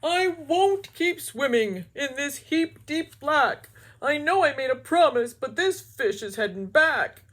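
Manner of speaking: scared cartoon fish voice; frightened fish. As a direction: whine that he's turning back. Mister Fish gives up.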